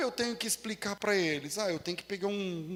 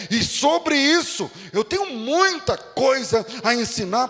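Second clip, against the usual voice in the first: emphatic scripture reading; 9 dB louder, slower than usual. Acted. Reading Hebrews verse aloud, emphatic.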